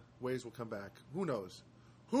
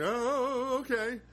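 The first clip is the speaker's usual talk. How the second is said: high, loud, bright "oh okay"; mock startled agreement; surprised; 10 semitones higher, 6 dB louder, much slower than usual. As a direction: caught off guard, pitched way up. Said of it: "at first I was like".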